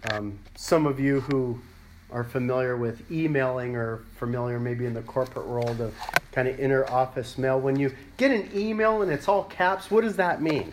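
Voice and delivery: ordinary talk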